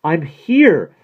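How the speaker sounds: high, very loud shout; crying out. A narrator's reading. Maggie reaches the top of stairs, crying out. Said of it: "she cried breathlessly".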